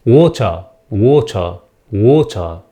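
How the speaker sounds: "Water" British style with the R dropped; British accent. Shown as a demonstration. English teacher shows the silent R in British accent. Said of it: "النطق البريطاني لا ينطقون ال R".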